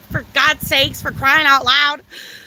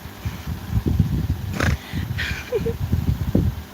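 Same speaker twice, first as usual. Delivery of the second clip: breathless, wheezing laugh; silent laugh; 8 semitones higher, 7 dB quieter than usual. Real, not acted.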